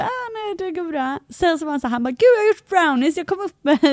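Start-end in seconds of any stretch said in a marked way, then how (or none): 0.0-1.2 high, soft little brush-off; imitated awkward no-thanks; retold polite refusal. Acts out her polite refusal. 2.1-3.8 high, loud imitation of a keen neighbour; imitated excited offer; mock eager announcement. Acts out neighbour announcing brownies.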